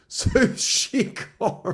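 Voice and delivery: high, slow, laughing words. Real, not acted. Telling viewers her account got deleted, laughing. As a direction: let the laugh break through.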